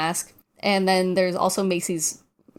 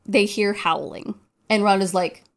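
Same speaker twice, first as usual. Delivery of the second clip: usual speaking voice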